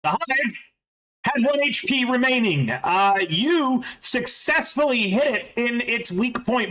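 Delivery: slow, measured game master scene setting; GM narration. Acted. Game master describes the hive's collapse to players, slow narration. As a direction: narrate the wreck crumbling, taking your time.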